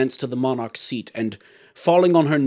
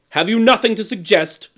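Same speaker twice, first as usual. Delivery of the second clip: loud, raised, demanding question; impatient; 10 semitones higher, 7 dB louder than usual. A narrator's reading. King demanding suggestions from the jester, impatient. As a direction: press him for an answer. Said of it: "said the king impatiently".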